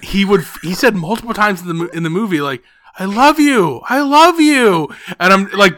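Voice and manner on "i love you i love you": put-on high, loud child's declaration; imitated kid yelling. Moviegoer imitating kid during the movie, high mock yell. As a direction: blurt it out loudly, mimicking an oblivious kid. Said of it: "but like that at room volume".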